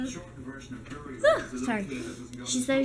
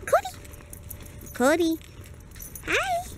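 high calling voice; 10 semitones higher than usual. Real, not acted. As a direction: call the dog cheerfully. Calling the dog.

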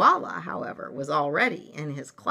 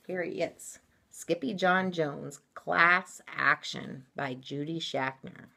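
usual speaking voice

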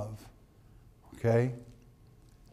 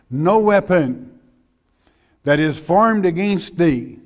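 preaching scripture; 6 dB louder than usual. Acted. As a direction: proclaim every word to the back pew. Preacher reads scripture aloud forcefully.

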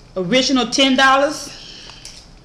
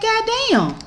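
high-pitched stunned aside; squeaky-high mock astonishment; retold amazement; 12 semitones higher than usual. Retold amazed reaction to the offer.

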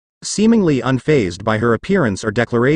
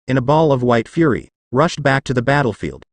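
ordinary delivery, nothing marked

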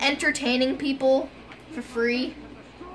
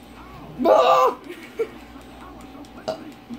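high shrieking laughter; 11 semitones higher than usual. Real, not acted. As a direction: burst out laughing. Laughing fit.

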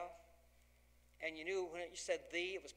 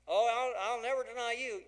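much louder, higher protest; loud boastful Peter; 6 semitones higher, 10 dB louder than usual. Acted. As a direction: shout the promise, full of himself. Peter's boastful promise voiced.

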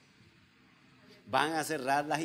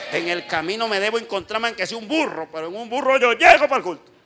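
loud, raised voice building to a shout; rousing declaration; 7 semitones higher, 12 dB louder than usual. Acted. Believer vowing to reach church on a donkey, rousing shout. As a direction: build up and belt out the end.

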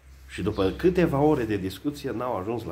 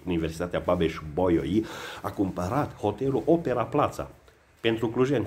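ordinary talk

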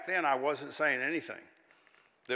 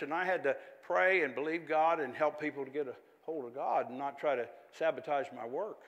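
ordinary talk, nothing marked

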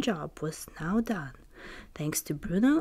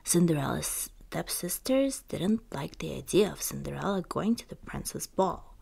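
plain reading voice

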